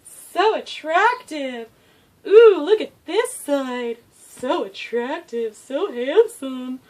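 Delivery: slow, high gushing admiration. Real, not acted. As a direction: swoon over a handsome dog. Gushing over dog's looks.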